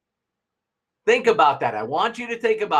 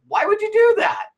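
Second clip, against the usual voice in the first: much higher, mocking why would you do that; incredulous friend; 3 dB louder than usual. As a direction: squeak it out in disbelief. Friend quizzing about long drive.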